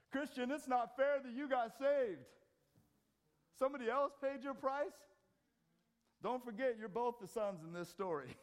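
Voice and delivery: high, loud, ringing pulpit challenge; preaching at full force. Acted. Preacher confronting the congregation, full-force preaching. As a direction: confront the church with the point.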